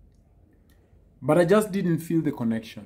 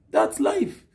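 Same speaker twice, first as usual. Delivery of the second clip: high emphatic repeat; 12 semitones higher than usual. Shown as a demonstration.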